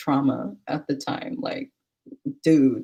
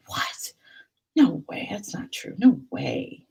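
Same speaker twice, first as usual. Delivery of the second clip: hushed, airless whispered shock; stunned disbelief; slower than usual. Retold or imitated. Reacting to friend's news of a death, stunned whisper. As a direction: whisper it as if reeling.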